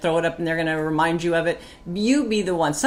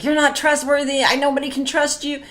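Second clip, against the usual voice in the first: higher, louder accusation; old accusing outburst; 9 semitones higher, 4 dB louder than usual. Retold or imitated. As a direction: fling the accusation at him. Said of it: "I used to be like".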